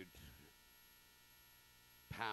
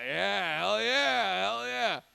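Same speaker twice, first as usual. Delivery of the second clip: loud cheer; 5 semitones higher, 10 dB louder, slower than usual. Real, not acted. Comic greets crowd loudly.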